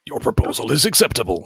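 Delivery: deadpan alien announcing its terms. Retold or imitated. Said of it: "to which the alien goes".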